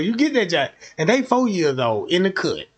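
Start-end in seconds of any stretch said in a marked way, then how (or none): none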